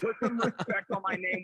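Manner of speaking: half-laughing, mock indignant; laughing demand. Real, not acted. Guest laughing as he demands respect from the hosts.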